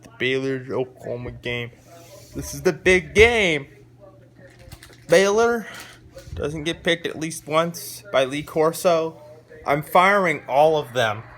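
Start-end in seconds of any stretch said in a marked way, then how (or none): 2.4-11.2 loud excited build-up; hyped up. Real, not acted. Fan hyping his friends for the pick, loud and excited.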